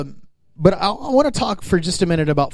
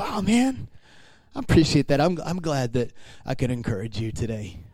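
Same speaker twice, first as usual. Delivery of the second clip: soft, sincere acceptance; humble thanks; 3 semitones lower, 6 dB quieter than usual. Shown as a demonstration. Believer modelling how to take a compliment, humble. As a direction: take the compliment quietly and simply. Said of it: "What you should do is go".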